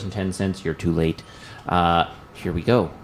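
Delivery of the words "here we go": ordinary delivery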